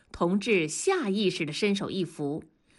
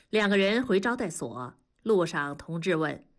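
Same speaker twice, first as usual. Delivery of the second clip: ordinary delivery, nothing marked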